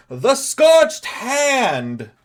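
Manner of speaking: high, slow, drawn-out herald call; announcer shout. Acted. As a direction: proclaim the name to the crowd. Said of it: "he goes".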